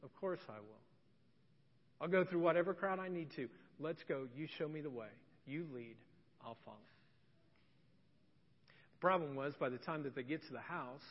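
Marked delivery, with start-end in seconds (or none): 0.0-0.8 soft, low, tender reply in a retelling; compassionate. Retold or imitated. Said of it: "looks at him with compassion". 2.0-6.8 gentle reassurance, getting lower and softer; compassionate. Retold or imitated. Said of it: "looks at him with compassion".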